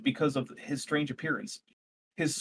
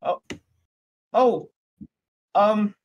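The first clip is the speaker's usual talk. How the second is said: higher, startled NPC doctor; a shaken scientist caught off guard; nervous doctor voice; 6 semitones higher, 4 dB louder than usual. Acted.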